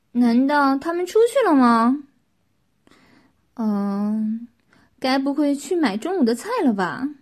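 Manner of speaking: loud, puzzled thinking out loud; wondering aloud. A narrator's reading. Qin alone outside the silent room, loudly wondering to oneself. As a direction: puzzle it out at full voice. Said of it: "秦自言自语道".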